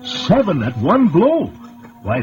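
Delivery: usual speaking voice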